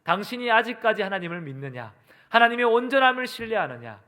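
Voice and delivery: needling, forceful acted rebuke; goading wife. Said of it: "염장 지르는 이야기를 합니다".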